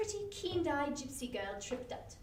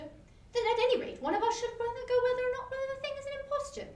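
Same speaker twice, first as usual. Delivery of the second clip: higher, louder doctor's voice; voiced doctor; 10 semitones higher, 4 dB louder than usual. A narrator's reading. Doctor urging his friend, raised and eager.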